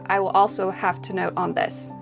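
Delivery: ordinary delivery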